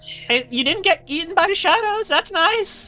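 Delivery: put-on very high, bubbly little girl voice; a bouncy small kid greeting friends; Silly the cheerful child. Silly voice continues.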